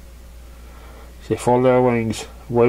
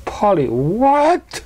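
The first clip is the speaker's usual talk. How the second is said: high, slow puzzled question; baffled; 8 semitones higher, much slower than usual. Acted. Pinkie lost in the big ponies' jargon, baffled. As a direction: draw it out, totally lost. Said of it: "said Pinkie Pie, turning her head".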